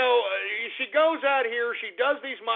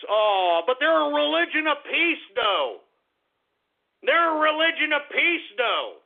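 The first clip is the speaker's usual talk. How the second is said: sarcastic mockery; 8 semitones higher, 5 dB louder than usual. Real, not acted. Sarcastic jab at Islam claim.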